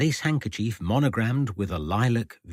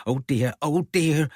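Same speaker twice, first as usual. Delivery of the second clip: put-on Norfolk accent; 6 semitones higher than usual. A narrator's reading. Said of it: "a stronger version of her usual Norfolk accent".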